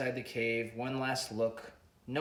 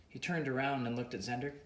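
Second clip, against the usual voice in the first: ordinary delivery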